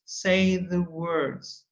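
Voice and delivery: slow repeat. Shown as a demonstration.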